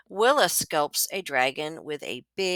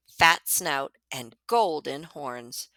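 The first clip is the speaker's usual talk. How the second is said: usual speaking voice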